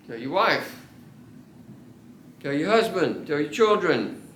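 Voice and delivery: normal talking voice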